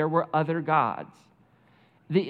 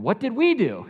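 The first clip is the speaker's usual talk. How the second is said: panicked sailors; 6 semitones higher, 5 dB louder than usual. Retold or imitated. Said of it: "So they start going".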